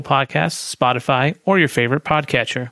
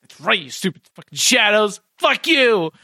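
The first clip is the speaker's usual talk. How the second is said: higher, gloating yell at shadows; triumphant; 7 semitones higher than usual. Acted. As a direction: gloat with a high triumphant shout. Cheering at retreating shadows.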